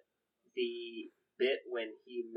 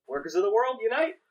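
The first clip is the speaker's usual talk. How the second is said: high, loud rallying cry; Dan Aykroyd; 10 semitones higher, 8 dB louder than usual. Retold or imitated. Quoting the line as both shoot the agent, rousing shout. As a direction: shout it out, pitch climbing to the end. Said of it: "it's in the way he says it".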